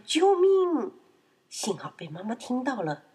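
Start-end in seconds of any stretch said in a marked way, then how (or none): 0.0-0.9 high, loud, drawn-out shriek; screaming for help. Acted. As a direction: cry out as a panicked piglet. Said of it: "闹闹尖叫着喊". (尖叫着呼救)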